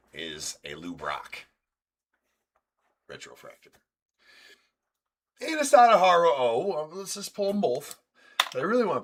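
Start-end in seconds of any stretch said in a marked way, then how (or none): 5.4-9.0 high, loud excited call; loud high excitement. Real, not acted. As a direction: hype it way up. Hyped card break wish.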